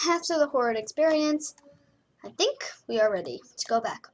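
normal talking voice

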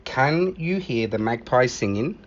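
plain reading voice

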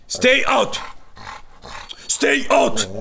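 high-pitched command to a dog. Real, not acted. Owner ordering dogs to stay out, commanding.

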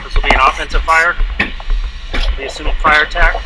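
loud distorted radio report; shouting over a crackling handheld radio; urgent firefighter radio. Real, not acted.

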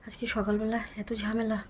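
hushed, voiceless whisper; real secretive whisper. Real, not acted. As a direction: whisper so no one nearby hears. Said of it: "আমি খুবই আস্তে বলছি".

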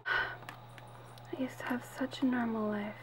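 slow, sad whisper with a sigh. Acted. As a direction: sigh it to yourself, homesick. Alone, lamenting her life.

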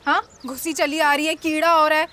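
indignant imitation of his rudeness; mocking his tone. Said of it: "tone देखी थी आपने अपनी?".

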